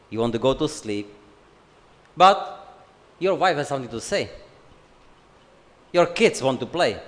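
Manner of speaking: normal talking voice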